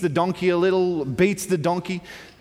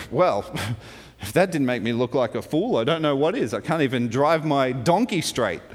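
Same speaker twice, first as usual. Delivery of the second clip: low, lightly voiced grumble; grumbling; 6 semitones lower than usual. Balaam embarrassed by his straying donkey, grumbling to himself. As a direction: grumble under a short laugh, pitched down.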